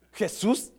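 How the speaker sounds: ordinary delivery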